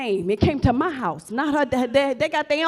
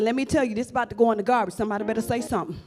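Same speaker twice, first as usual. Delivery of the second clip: quick retold warning; 4 semitones lower, 7 dB quieter, much faster than usual. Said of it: "I said".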